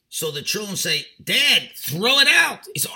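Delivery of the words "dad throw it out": loud, slow, begging the father; pleading shout. Acted. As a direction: drag it out, louder, like desperate kids. Children beg father, smell unbearable.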